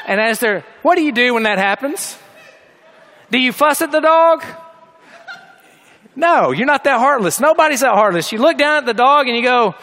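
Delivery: normal talking voice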